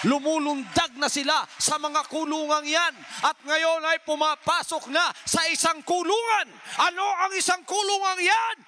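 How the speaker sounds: building from strong to high, loud shouting; preaching at full force. Acted. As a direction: drive the sermon up to its peak.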